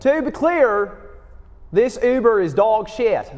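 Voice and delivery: high, loud, hammed-up Southern accent. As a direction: put on a big, drawn-out Southern twang. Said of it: "Better than your Southern accent".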